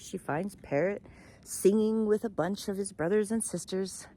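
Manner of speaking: usual speaking voice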